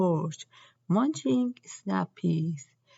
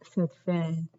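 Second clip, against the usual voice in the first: plain reading voice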